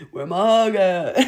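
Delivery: mock needy guy.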